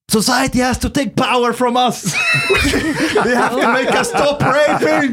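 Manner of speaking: hammed-up fervent preacher yelling through laughter; mock religious fervor. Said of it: "stands up in religious fervor and says".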